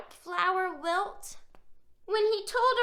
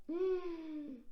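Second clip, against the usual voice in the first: plain reading voice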